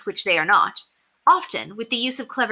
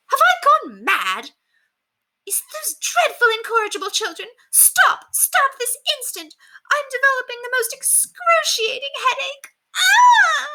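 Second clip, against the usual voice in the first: very high, shrieking indignant society lady; yelling grand lady; 4 dB louder than usual. A narrator's reading. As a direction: shriek like an outraged dowager. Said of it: "stood up and yelled".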